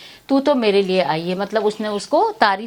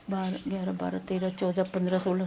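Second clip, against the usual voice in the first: very soft, low counting to oneself; tally quickly under your breath; hushed, low private count; 4 semitones lower, 11 dB quieter than usual. Real, not acted. Teacher counting items to herself.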